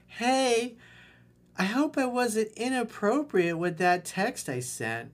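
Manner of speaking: put-on high, slow sugary girl voice.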